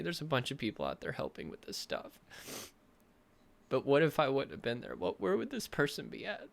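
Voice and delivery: pushing words out through tears; emotional crying. Real, not acted. Coach to viewers, tearfully imagining the client alone. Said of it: "I wasn't planning on crying".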